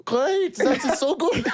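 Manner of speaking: put-on high comic German-accented reply; overly pleased, in a put-on German accent. Keeping the charade up for the stranger, high German accent. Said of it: "keep this charade on".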